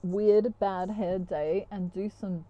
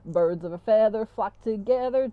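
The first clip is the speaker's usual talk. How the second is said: loud, playful banjo-song delivery; banjo-playing twangy singer; 6 dB louder than usual. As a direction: belt it like a banjo number. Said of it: "she gets her banjo out".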